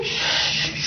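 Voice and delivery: unvoiced shush; calming a panicking neighbour with a shush; hush. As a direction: hush her sharply under the breath. Hushing the panicking woman.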